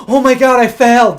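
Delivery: high, loud imitated panic; mock panic. Recalling driving test mistake, loud high mock panic. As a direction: replay the moment you knew you'd failed.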